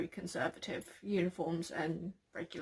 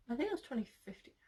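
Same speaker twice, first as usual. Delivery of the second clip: fast whispered aside to self; whispering; 5 semitones higher, 7 dB quieter, much faster than usual. Real, not acted. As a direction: mutter it under your breath.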